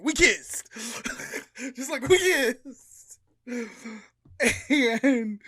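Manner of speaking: loud, high blurted confession with a laugh; spring the big shock on them; loud laughing announcement; dramatic reveal. Acted.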